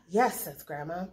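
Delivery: ordinary delivery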